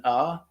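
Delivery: "ah" as an open American vowel. Shown as a demonstration. Coach showing the American sound, open "ah". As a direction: open the "ah" wide, American style. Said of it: "a very American sound".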